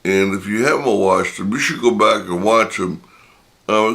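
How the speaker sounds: ordinary delivery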